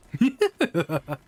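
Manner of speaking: high, loud burst of laughter. Real, not acted. Streamer reacting during chat about food, amused laugh.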